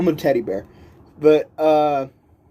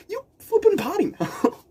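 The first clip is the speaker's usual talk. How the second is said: clutch pearls at her swearing; squeaky scandalized granny voice; mock scandalized lady. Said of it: "And I'm like".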